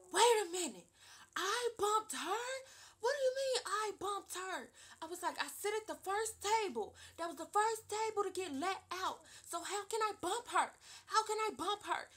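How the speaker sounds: high, loud, disbelieving retold protest; loud incredulous teen defending herself; indignant outburst. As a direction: rear up in outrage at being blamed. Retold outrage at false accusation.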